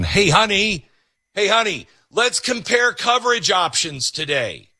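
high, chirpy pretend enthusiasm; mock eager spouse. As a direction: call out to your partner, hammed-up keen.

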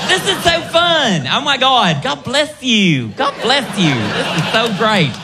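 high, slow Southern belle gushing; Georgia peach lilt. Acted. Comic imitating a delighted Southern woman, lilting Georgia accent. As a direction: gush with a drawn-out Southern lilt. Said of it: "it's got that Georgia peach lilt to it".

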